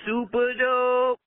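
high, slow shouted super dope; catchphrase shout. Real, not acted. Caller on voicemail shouting the catchphrase, drawn out. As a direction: belt out the show's name.

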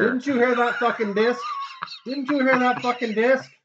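squeaky laughing shout; laughing, high pitched. Acted. High loud laughing repeat.

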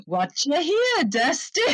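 high-pitched hammed-up American accent; mock American accent. Shown as a demonstration. British host tries an American accent for Dustin, hammed-up. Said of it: "The pressure's on for the American accent now".

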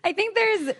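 real laughter breaking into the words; high, helpless laughing while trying to continue. Real, not acted. Breaks up laughing mid-sentence.

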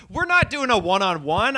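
ordinary talk, nothing marked

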